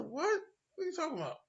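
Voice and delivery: shoots way up high in shock; stunned disbelief. Retold or imitated. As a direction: blurt it out, can't believe it. Hears the Pentagon was hit.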